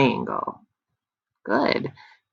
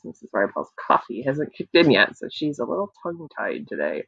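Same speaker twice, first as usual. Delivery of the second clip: ordinary talk, nothing marked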